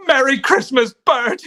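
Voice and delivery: joyful shout. Acted. George overjoyed, shouting.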